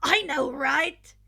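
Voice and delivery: put-on loud, slow proud boy voice; grinning. Kirishima proud of his cone, loud and grinning. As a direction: own it with a grin. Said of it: "Ichigo grinned".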